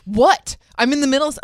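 loud, irritated phone-answer imitation; retold bad-tempered outburst; imitating vile snapping. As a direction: snap it impatiently, mimicking a grumpy friend. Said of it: "like, vile".

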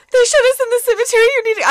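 high, loud, rushed tearful babble; mock bawling. Telling a friend by phone while bawling, mock-hysterical. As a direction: imitate a panicked, sobbing phone plea. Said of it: "I'm, like, bawling".

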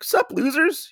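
high sneering put-on voice; mocking greeting. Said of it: "he's like".

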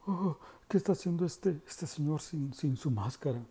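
imitando, agudo, a los que lo miran raro; voz burlona de la gente. Retold or imitated. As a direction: remeda con sorpresa exagerada a la fila. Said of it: "como si". (burlándose de los que lo miran, agudo y asombrado)

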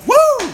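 very high wrestler's "woo"; short high-pitched whoop; Ric Flair. Retold or imitated. Imitating a wrestler's catchphrase, high whoop. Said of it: "He's like Ric Flair".

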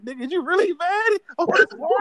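high, loud mock disbelief; mocking friend. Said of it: "looked at me like".

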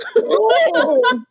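loud high-pitched laugh. Real, not acted. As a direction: shriek of laughter. Laughs after joke.

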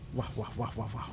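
low muttered wah wah; muffled wah wah talk. Real, not acted. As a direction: murmur wah under breath. Muttering to himself.

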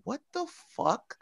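high, hushed, slow disbelief; stunned disbelief. Retold or imitated. Reacting to her gun question, stunned.

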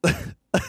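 short burst of laughter. Real, not acted. Laughing at failed harmonizing attempt.

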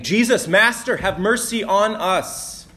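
high, loud plea for mercy; calling out. A narrator's reading. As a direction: call out from a distance, drawing out the plea. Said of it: "they called out".

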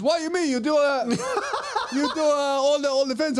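put-on high, puzzled Chinese lady's accent; high-pitched Chinese-accented questioning. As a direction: mimic a baffled woman with a Chinese accent. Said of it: "do the accent for that story".

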